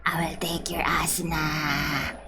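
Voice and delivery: low, soft near-whisper; hushed mock threat. Streamer menacing Kirito over a scam, hushed mock threat. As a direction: mutter the threat under your breath. Repeats the threat under breath.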